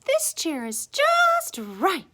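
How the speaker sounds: high, slow, loud approval; delighted Goldilocks. A narrator's reading. Goldilocks rocking in the perfect chair, high delighted voice. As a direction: draw it out in a happy little-girl voice.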